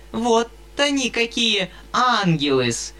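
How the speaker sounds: a hint of a squeaky fawning spinster; squeak. A narrator's reading. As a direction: squeak out flattery in a thin high voice. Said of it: "пропищала ... девица Перепелицына".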